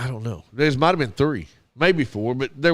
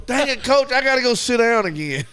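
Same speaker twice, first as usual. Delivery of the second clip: much higher, whiny letdown; frustrated kid; 9 semitones higher than usual. Retold or imitated. Bleeding boy complaining to his coach, high and whiny. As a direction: whine it high like a let-down little boy.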